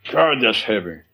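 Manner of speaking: a hint of a stoner marveling; stoned awe. A mildly stoned guy marveling at a big thought. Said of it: "especially if they smoke a little dope".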